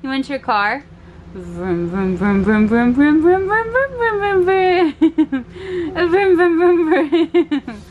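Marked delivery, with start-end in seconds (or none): 1.3-6.1 put-on playful engine revving for a little boy; car engine noises. Making car noises for toddler. 6.1-7.8 slow car noises broken by laughter; giggling engine sounds; giggly vrooms. Pretend.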